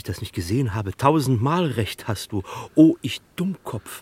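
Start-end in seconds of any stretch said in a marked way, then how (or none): 2.7-4.0 higher, louder cry at his own stupidity; self-scolding outburst. Acted. Gloster cries out at himself for missing the likeness, loud and high. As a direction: slap your forehead and cry it out, slowly.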